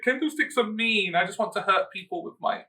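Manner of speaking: high, naive goody-goody voice; sweet innocent voice. Retold or imitated. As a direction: play it wide-eyed and innocent. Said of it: "and she's so".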